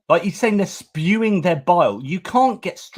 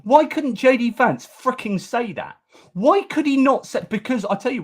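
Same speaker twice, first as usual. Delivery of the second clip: much higher, indignant outburst; exasperated rant; 9 semitones higher than usual. Real, not acted. Vlogger venting at Vance's dodge, exasperated high outburst. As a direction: demand it like you can't believe it. Ranting about JD Vance's non-answer.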